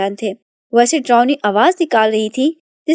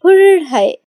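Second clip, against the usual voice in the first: a high, louder wheezy whistle sound through the lips; whistle snore imitation; 6 semitones higher, 3 dB louder, much slower than usual. A narrator's reading. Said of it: "سانس لیتی تو آواز نکلتی ہے". (سیٹی سے نکلتی خراٹے کی آواز)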